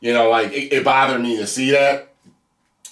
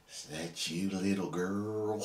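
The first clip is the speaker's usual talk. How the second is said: creepy; 6 semitones lower, 17 dB quieter than usual. Retold or imitated. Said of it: "that's so damn creepy".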